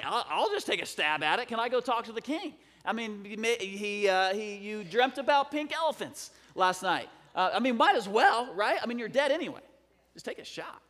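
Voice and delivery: high, loud, animated stammering pitch; nothing-to-lose excitement. Retold or imitated. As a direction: talk yourself into a wild gamble. Voices Daniel's reckless gamble.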